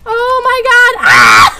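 high, loud panicked wailing; terrified. Real, not acted. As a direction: cry out as if about to die. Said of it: "not an appropriate song for my terror".

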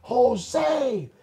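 booming call of his name; a thunderous voice calling Jose; thundering call. Retold or imitated. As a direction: call out like a voice from above. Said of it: "It was like thunder".

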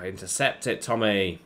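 usual speaking voice

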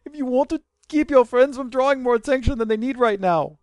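a mixed-up mock accent; accent drifting to Indian. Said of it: "sound like I'm Indian now".

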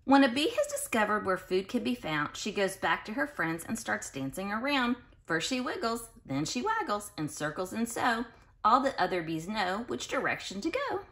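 lilting read-aloud storybook voice; sing-song storybook reading; reading to children. Acted. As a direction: read it like a bedtime picture book to little kids. Reads picture book to children.

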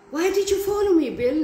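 high, loud startled cry; frightened stutter. A narrator's reading. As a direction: voice jumps up in alarm. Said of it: "Nancy stuttered".

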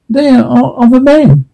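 second take, rising to joy on men; growing excitement. Acted. Father repeating the news to himself, excited on men. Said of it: "Pa realizes".

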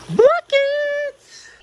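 high, drawn-out cheer; high-pitched triumphant whoop; celebratory yell. Real, not acted. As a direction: call it out high like a score. Celebrating a basketball shot.

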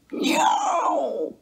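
high, drawn-out cat mew; a long, high miaow; cat meow. A narrator's reading. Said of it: "The cat mewed in answer".

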